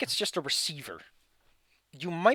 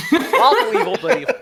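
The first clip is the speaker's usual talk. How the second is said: laughing; 11 semitones higher, 14 dB louder than usual. Real, not acted. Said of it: "Now that's evil".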